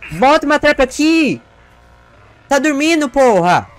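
loud high furious yell; angry yell. Real, not acted. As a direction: scream at the lazy teammate. Yelling at idle teammate.

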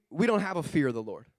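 usual speaking voice

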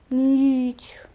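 breathy dramatic whisper of one word; spooky whisper. Acted. Gamer whispering to the audience as night falls, spooky whisper. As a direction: hush it like a ghost story.

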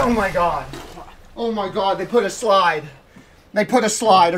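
can't believe the slide is there; disbelief. Real, not acted. Streamer stunned that the game added a slide.

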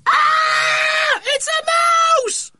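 very high, loud shriek of terror; terrified scream. A narrator's reading. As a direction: scream it in panic, drawing out the last word. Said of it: "there was a terrified scream from within".

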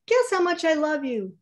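little hare's high, bright question. Acted. Little hare asks his father, high childlike voice. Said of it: "he said".

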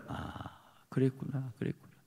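breathy soft murmur; hushed realization. Retold or imitated. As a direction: whisper as it dawns on you. Quiet realization in prayer. (깨달으며 작게 속삭이듯)

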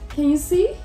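plain reading voice

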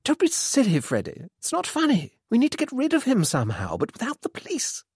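high, urgent scolding voice; flustered mother, scolding. A narrator's reading. Mother scolds her son about the body, flustered. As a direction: scold in a high flutter, hushing at the end.

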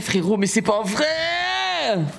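rising, long-drawn cry of disbelief; exasperated wail. Real, not acted. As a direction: stretch the last word into a wail. Joueur abattu, plainte qui monte. (longue plainte aiguë, incrédule)

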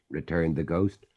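ordinary delivery, nothing marked

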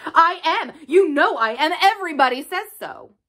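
high-pitched indignant protest; indignant cry. A narrator's reading. Colin protesting to unsympathetic Mary, crying out indignantly. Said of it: "he cried".

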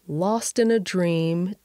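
usual speaking voice

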